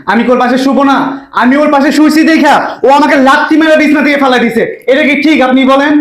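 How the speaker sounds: high, indignant pleading; aggrieved complaint. Acted. Husband complains to father-in-law.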